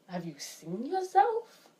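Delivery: slightly incredulous, drawn-out question. Retold or imitated. To a friend who calls herself ugly, mildly incredulous. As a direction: stretch it out with a rising, disbelieving tune. Acted retort to a self-critical friend.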